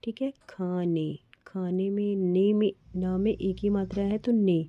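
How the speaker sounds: ordinary talk, nothing marked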